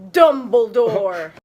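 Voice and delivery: ordinary delivery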